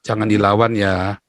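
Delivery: low, slow imitation of a calming father; gently caution, as a father would; nasihat ayah. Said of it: "ayah saya selalu bilang apa". (menirukan ayah menenangkan, pelan dan rendah)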